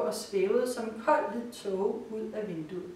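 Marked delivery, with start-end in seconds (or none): none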